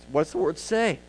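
normal talking voice

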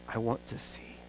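near-whisper, very soft; hushed plea. Acted. Bartimaeus answering Jesus with his one wish, near-whisper. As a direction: breathe out the wish quietly.

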